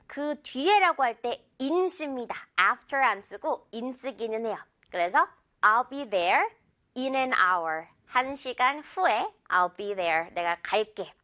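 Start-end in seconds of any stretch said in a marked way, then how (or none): none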